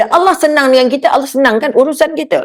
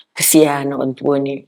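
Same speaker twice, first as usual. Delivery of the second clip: soft pitiful plea; 9 semitones lower, 5 dB quieter than usual. Acted. Customer pleading for pity in quote.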